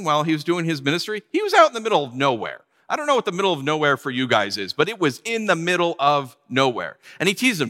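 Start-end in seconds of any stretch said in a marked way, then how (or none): none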